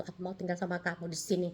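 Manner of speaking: ordinary talk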